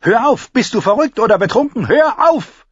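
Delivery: loud, high-pitched scolding; angry shout. Acted. Cersei shaking her laughing brother, shouting angrily. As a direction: yell at him to stop.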